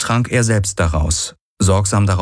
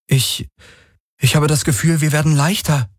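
higher, awed and hesitant; childlike wonder; 8 semitones higher than usual. Acted. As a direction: speak like a child in awe. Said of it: "wie ein staunendes Kind".

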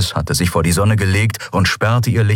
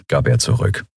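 usual speaking voice

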